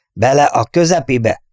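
high, loud mock order; commanding. A narrator's reading. Wall parodying the commander's orders, commanding. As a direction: call it out like a drill command. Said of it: "vezényelt Wall".

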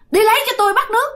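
high, strange womanish voice ordering water; high eerie squeaky voice. Retold or imitated. Possessed man orders water from H, high eerie womanish voice. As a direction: demand the water in a weird high ghost voice. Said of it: "cái giọng cao eo éo kỳ lắm".

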